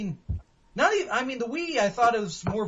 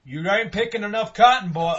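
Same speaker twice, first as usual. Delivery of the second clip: mock Southern master; 5 semitones higher, 10 dB louder than usual. Mock plantation owner.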